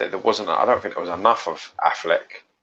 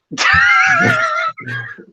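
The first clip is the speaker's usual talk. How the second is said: very high screaming laughter; laugh-scream at the joke name; shrieking laugh; 3 dB louder than usual. Real, not acted. Reacting to "Dick Shitter.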